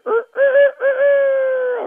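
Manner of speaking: very high, loud imitated cock crow; rooster crow. Narrator imitating the rooster after Peter's denial, high and loud. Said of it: "a rooster crows".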